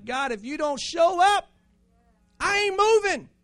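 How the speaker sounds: loud, very high defiant shout to God; shouting prayer. Shown as a demonstration. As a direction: refuse to budge, yell it out. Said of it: "just scream out to God".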